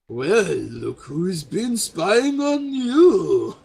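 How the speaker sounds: loud, high, slow mock delight; gloating reveal. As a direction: crow it out, stretching the words, with a laugh after. Villain NPC exposes spy to drow.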